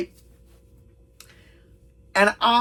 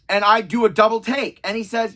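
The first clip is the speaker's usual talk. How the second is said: ordinary talk, nothing marked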